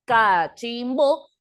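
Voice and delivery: "cachimbo" slowly split into three beats, ca-chim-bo; syllable by syllable. Shown as a demonstration. Teacher counting the beats of a word, "cachimbo" said slowly by syllables. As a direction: draw out each syllable of "cachimbo" separately. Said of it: "cuántos golpes de voz tiene".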